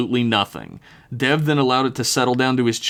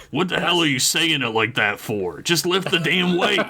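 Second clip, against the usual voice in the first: gruff, exasperated dad snapping at his son; growling dad; 7 semitones higher than usual. A narrator's reading. Dad berating his son at the bench, growled. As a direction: growl it out, rising in irritation. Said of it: "grimaced and growled out".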